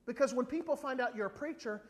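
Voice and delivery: ordinary delivery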